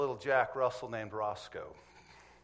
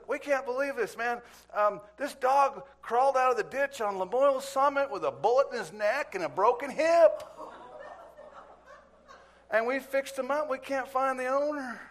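high, loud, excited retelling; acted-out marvelling shelter worker, building the comedy; amazed; 11 semitones higher, 6 dB louder than usual. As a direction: exclaim it in disbelief, pitch way up. Shelter worker marvels at dog's survival.

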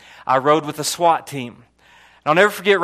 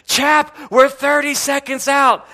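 calling over engine noise; 8 semitones higher, slower than usual. Retold or imitated. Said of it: "he looks at me and he goes".

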